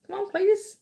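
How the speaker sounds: high mock taunt; high put-on taunting voice. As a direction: goad him in a high silly voice.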